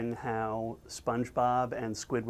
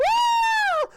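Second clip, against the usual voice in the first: piercing, very high loud shriek; cartoon scream; 7 dB louder than usual. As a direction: scream at full pitch like a terrified cartoon. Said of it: "Sponge screams".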